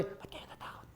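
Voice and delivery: whispered question. Shown as a demonstration. Preacher testing a gentle Jesus, whispered question. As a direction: breathe it without voice. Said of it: "Alam nga sabi".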